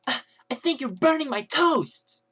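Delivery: loud, high, stretched-out whiny yell; yelled complaint. Retold or imitated. Girl yelling at the kiosk guy about her toast, loud complaint. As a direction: mimic a girl yelling rudely across the room. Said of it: "and yelled out".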